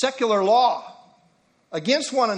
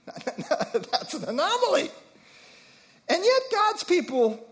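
laughing; 10 semitones higher than usual. Real, not acted. Laughing after joke.

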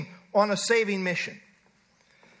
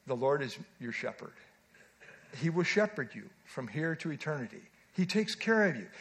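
tender reassurance; 6 semitones lower, 6 dB quieter than usual. Retold or imitated. Comforting dying mother with psalm.

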